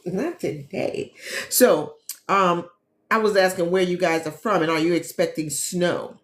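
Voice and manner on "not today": laughing. Real, not acted. Laughing refusal to travel.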